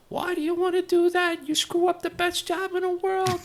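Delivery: very high, squeaky old-timer impression; Joe Selly's high-pitched voice. Old-timer objects to colleague getting married, high-pitched impression. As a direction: mimic his protest far up in pitch. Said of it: "another Joe Selly story".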